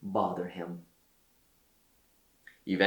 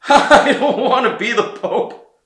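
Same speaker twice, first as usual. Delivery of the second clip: much higher, louder wailing cry; weeping; 14 semitones higher, 12 dB louder than usual. A narrator's reading. Old Peter wails to the messengers, weeping protest. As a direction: wail it through tears. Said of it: "he wept".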